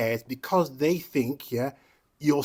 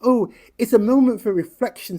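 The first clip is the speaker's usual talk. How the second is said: mocking politician; 7 semitones higher, 7 dB louder than usual. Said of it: "said Andy Burnham".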